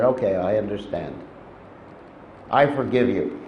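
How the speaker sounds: calm the anger down into quiet forgiveness; softly forgiving. Acted. Shiva calmed after her explanation.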